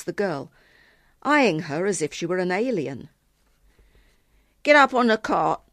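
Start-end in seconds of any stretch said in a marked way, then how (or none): 4.6-5.6 put-on loud, raised-pitch command from a boy; ordering. A narrator's reading. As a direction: order her about, louder. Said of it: "he ordered".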